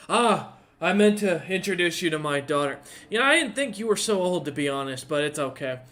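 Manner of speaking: put-on loud drunken lord. Drunk lord greets guest loudly.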